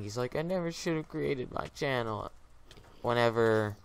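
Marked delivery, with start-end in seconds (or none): 0.3-2.3 higher-pitched, mildly whiny imitation; mock crying. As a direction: imitate someone tearfully regretting it. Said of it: "he's, like, crying".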